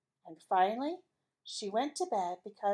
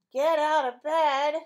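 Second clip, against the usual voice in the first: slow, louder, higher title call; title announcement; 5 semitones higher, 8 dB louder, slower than usual. Acted. Host announcing a story title with slow excitement.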